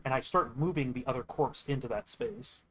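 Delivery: ordinary delivery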